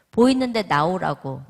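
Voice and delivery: loud, exasperated retold order; insistent demand. Mother ordering her son off the computer, loud exasperated insistence. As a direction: push the demand out forcefully.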